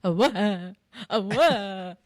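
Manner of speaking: light mock Italian accent on "Oh, wah."; playful Italian-accented baby wail; Italian baby cry. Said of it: "Or an Italian".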